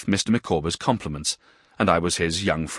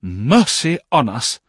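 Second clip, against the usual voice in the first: put-on slow, louder old lady's cry; shocked exclamation; 5 semitones higher, 5 dB louder, slower than usual. A narrator's reading. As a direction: fire it off like a minute gun. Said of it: "ejaculated at intervals".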